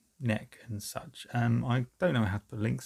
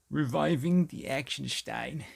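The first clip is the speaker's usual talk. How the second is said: slow, heavy mock German accent, higher; 4 semitones higher than usual. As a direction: play it up like a German metal host. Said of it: "I don't know why I do a German accent".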